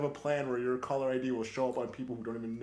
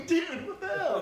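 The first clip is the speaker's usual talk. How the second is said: much higher, laughing disbelief; baffled customer; 5 dB louder, slower than usual. Retold or imitated. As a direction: squeal it like the confused customer.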